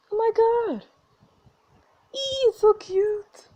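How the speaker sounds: high, loud squeal; high, drawn-out delighted gush; gushing over cuteness. Acted. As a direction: squeal at something adorable. Gushing over the cute robot.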